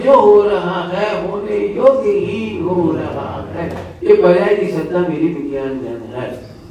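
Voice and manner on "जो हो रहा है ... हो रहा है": very slow, lilting emphasis; slow, drawn-out maxim. Real, not acted. Teacher giving devotees his core maxim, slowly drawn out. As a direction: stretch every word out slowly.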